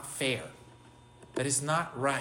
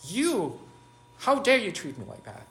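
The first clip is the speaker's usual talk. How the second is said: higher, louder indignant outburst; mock indignant how-dare-you, raised; angry retort; 7 semitones higher, 5 dB louder than usual. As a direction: snap back at someone who wronged you.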